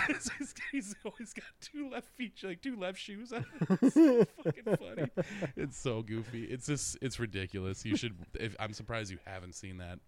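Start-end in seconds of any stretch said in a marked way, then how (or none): 0.1-5.1 high-pitched talking through laughter; real giggling fit while retelling a gag; cracking up. Real, not acted. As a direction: squeeze the words out laughing. Cracking up recalling the film.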